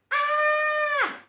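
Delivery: high piercing squeal of alarm; high loud cry. Acted. Broccoli with a drawn-on face, high squeal. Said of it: "cried Broccoli".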